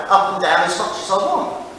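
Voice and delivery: usual speaking voice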